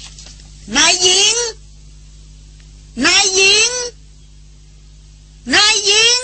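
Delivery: high, drawn-out shouting call; shouted call. A narrator's reading. Tracker calling for the trapped mistress, long high shout. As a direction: call her name far across the ground, slow and high. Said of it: "ตะโกนเรียก".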